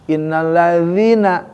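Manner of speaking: Innallazina slow, clear z sound; careful recitation. Shown as a demonstration. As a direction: say Innallazina slowly, biting the z. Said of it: "Hati-hati ya di Al-Fatihah".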